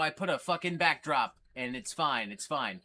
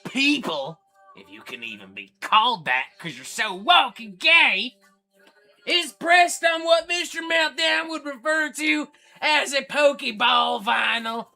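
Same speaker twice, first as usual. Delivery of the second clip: Thrashin' Jackson impersonation; 7 semitones higher, 10 dB louder than usual. Retold or imitated. Streamer impersonates metal fan Thrashin' Jackson for chat, screaming and hammed-up. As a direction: rant like a hammed-up thrash fanatic. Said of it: "That's what he would say".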